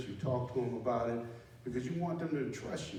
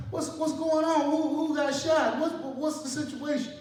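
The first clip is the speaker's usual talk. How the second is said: high, loud, breathless demands; breathless urgent shout; 8 dB louder than usual. Retold or imitated. Sergeant arriving out of breath, shouting at his soldiers. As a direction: burst in demanding answers. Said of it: "I'm out of breath".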